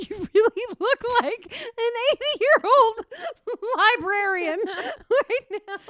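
laughing, high and loud; teasing through giggles; cracking up at her sister. Real, not acted.

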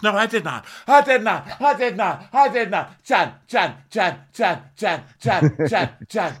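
high, loud, frantic repeated denial. Retold or imitated. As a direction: shriek the denial over and over. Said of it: "She goes".

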